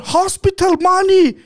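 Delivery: high, drawn-out demanding yell; slow, high-pitched shout; imitated shouting. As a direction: mimic the other man yelling for money. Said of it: "fängt an zu schreien".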